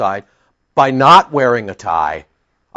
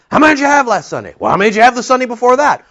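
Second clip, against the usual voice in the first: fast, higher, pressing questions; mock supervisor; 9 semitones higher, much faster than usual. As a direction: rattle off the questions like a nagging boss. Supervisor grilling about attendance.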